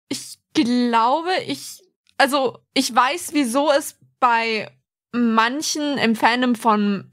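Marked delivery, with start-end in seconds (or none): none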